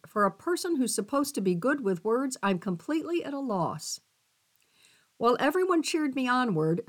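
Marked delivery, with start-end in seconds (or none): none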